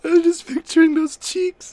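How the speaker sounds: high, slow squeal through laughter; helpless laughter. Real, not acted. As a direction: squeeze the words out while cracking up.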